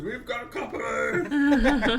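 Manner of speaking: high, loud, slow call with a laugh; yell a warning. Acted. Warrior waking his sleeping party, shouting a laughing warning. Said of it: "yell at the others".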